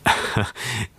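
short surprised laugh; a quick laugh of wonder; laughing at the returned money; delighted laugh. A narrator's reading. Keawe amazed by the magic.

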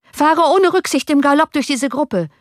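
high, loud urgent shout to the driver; high, loud cry ordering the coachman to gallop on. A narrator's reading. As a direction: yell it over the clatter of the carriage. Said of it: "schrie Johann dem Kutscher zu".

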